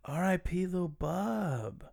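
slow, high mock eulogy; drawn-out solemn echo. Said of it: "echoes in mock solemn tone".

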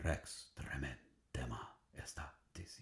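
awed whisper, broken into separate syllables; hushed, unvoiced chant with gaps between syllables; one syllable at a time. Shown as a demonstration. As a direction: whisper it haltingly in reverence. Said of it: "utter one syllable at a time".

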